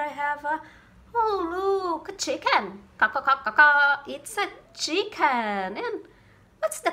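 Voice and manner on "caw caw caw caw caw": loud playful clucking caws, last one loudest; rising loud bird cries; bird call. Pretend. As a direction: crow out "Caw, caw" for the children. Teacher imitates bird for kids.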